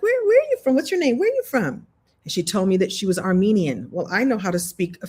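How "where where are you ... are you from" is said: higher, eager questions to a stranger; bright curious asking. Retold or imitated. Chatting up a bank clerk about where she is from, eager.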